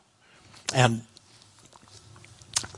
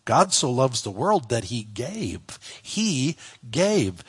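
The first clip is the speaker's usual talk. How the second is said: "gave" held out, then repeated with a pause; "gave" drawn out as the key word; stress on GAVE; slower than usual. Shown as a demonstration. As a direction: land hard on "gave" each time. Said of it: "put the emphasis of the verse".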